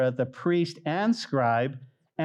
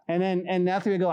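ordinary delivery

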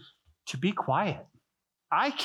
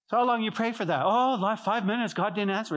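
high, breezy mock complaint; lightly voiced impatient believer; impatient pray-er; 6 semitones higher than usual. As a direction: toss off five minutes as if enough. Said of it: "mock dialogue about impatient prayer".

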